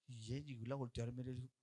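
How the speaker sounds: usual speaking voice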